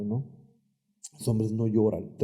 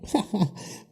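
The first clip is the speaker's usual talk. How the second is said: short laugh; 9 semitones higher than usual. Real, not acted. Laughs at own remark.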